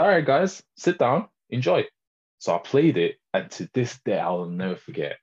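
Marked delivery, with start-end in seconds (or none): 0.0-1.8 mock host. Announcing the video to friends.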